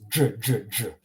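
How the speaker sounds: hard "j", tongue against the roof of the mouth. Shown as a demonstration. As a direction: repeat "j" as a hard jungle sound. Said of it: "the hard j, tongue to the, to the roof".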